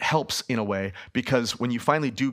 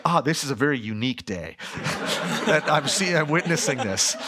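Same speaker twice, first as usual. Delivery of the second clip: prim bystander line broken up by laughter; mock-polite Canadian, laughing; 4 semitones higher than usual. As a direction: imitate a reserved local, giggling through it. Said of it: "they're like".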